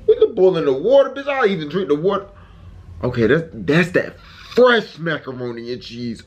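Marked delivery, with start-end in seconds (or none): none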